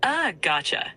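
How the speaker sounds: ordinary talk, nothing marked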